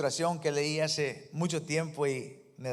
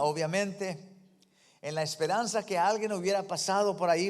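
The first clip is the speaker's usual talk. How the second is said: ordinary talk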